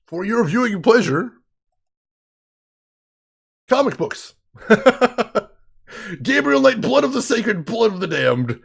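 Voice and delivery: hammed-up ringmaster announcing tonight's show; mock circus announcer presenting a comic book; showman announcer. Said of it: "showman announcement of comic books".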